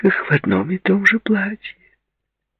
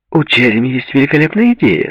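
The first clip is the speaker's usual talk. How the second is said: high-pitched, bubbly socialite; animated, higher-pitched enthusiasm; excited announcement; 8 semitones higher, 4 dB louder than usual. A narrator's reading. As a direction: burst in with exciting news. Shirley returns with an exciting plan.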